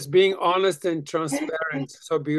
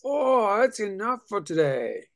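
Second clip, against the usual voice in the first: higher-pitched sigh of enough; mock overwhelmed; 5 semitones higher than usual. Student quoting her reaction to one intense sentence, mock overwhelmed. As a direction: throw up a higher, had-enough protest.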